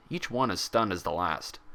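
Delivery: ordinary delivery, nothing marked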